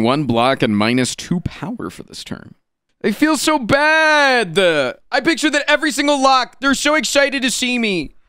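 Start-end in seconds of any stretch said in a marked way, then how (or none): 3.0-8.1 high, squeaky mock-sad voice; mock guilty. Streamer hamming up his guilt about the cute locks, squeaky high. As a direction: play up fake guilt in a tiny high voice.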